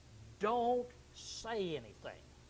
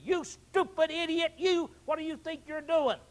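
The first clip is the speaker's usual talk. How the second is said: loud, high, slowed furious yell; hammed-up road rage scolding; bawling him out; 7 semitones higher, 9 dB louder than usual. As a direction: tear into the driver at full volume. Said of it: "just bawl him out".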